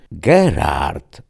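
name stretched slowly; drawn out. A narrator's reading. Enthroned lord addressing his servant, drawling. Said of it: "przeciągnęła istota siedząca na lewitującym tronie".

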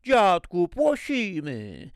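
put-on high, slow lisping little girl; lisping child. A narrator's reading. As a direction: lisp it like a small child missing front teeth. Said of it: "Zasepleniła Zosia". (sepleniąca dziewczynka)

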